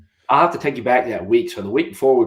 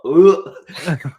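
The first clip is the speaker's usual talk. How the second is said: mock retch; 7 semitones higher, 9 dB louder than usual. Retelling throwing up on sideline.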